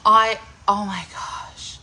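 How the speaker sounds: ordinary delivery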